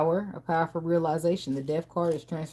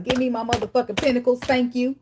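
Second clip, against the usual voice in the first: mock demand; 7 semitones higher, 5 dB louder than usual. Demanding the pentacles back.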